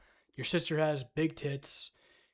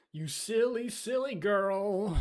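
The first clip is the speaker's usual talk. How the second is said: higher, slow teasing voice; teasing silly voice; 6 semitones higher, slower than usual. Pretend. Streamer teases a friend about notifications, high and slow. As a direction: tease her like a cartoon villain.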